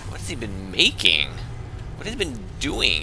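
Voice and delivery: usual speaking voice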